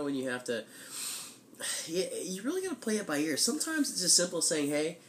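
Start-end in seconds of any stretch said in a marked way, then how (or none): none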